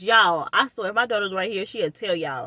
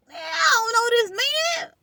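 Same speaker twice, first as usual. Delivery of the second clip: disbelieving outcry. Retold or imitated. Disbelief at the man on the phone, a high cracking cry.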